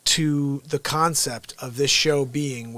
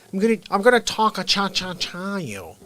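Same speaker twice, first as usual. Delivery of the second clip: Dennis Miller; 4 semitones higher than usual. Retold or imitated. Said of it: "Dennis Miller ... saying".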